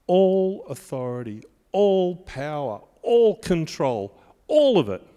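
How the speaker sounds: preacher building to a climax; building emphasis. Real, not acted. Preacher building on repeated "all.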